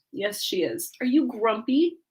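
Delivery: ordinary talk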